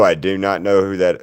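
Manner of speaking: usual speaking voice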